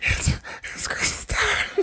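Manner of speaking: unvoiced high whisper. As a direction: whisper it back playfully. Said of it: "Why are you whispering?".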